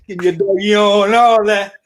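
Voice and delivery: ordinary talk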